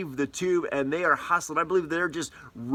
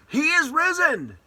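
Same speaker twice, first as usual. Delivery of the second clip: much higher, loud triumphant shout; shouted proclamation; 12 semitones higher, 5 dB louder than usual. Shown as a demonstration. Preacher shows the church what to shout from doorways, triumphant shout. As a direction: shout it out the door to the neighbours. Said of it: "shout these words".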